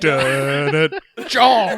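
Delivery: laughing, high, loud "Jaws!" shouted like a monster's name. Retold or imitated. Friend acting out the shark shouting its own name, loud and laughing. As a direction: bellow "Jaws!" through laughter. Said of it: "having Jaws shout its own name".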